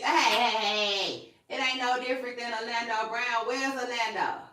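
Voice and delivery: normal talking voice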